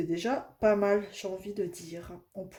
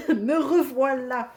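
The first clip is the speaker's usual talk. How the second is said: laughing; 5 semitones higher, 9 dB louder, slower than usual. Real, not acted. Laughs while greeting back.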